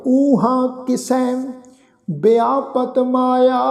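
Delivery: slow, drawn-out scripture chant; slow devotional recitation of the verse. Shown as a demonstration. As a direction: recite each word slowly and reverently.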